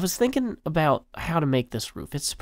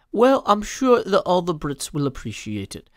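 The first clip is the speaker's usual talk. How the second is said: louder, higher plummy British accent; a posh British read; refined British accent; 4 semitones higher, 5 dB louder than usual. Shown as a demonstration. Trying a refined British accent for the Brits, louder and higher. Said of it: "a more refined British accent".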